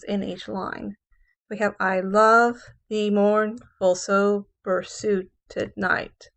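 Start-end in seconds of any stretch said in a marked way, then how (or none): 1.8-6.3 slow, sing-song beats on "love", "mourn", "so", "suit", "night"; five iambs. Shown as a demonstration. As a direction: tap out five weak-strong pairs, landing on "love", "mourn", "so", "suit", "night". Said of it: "there are five "I ams".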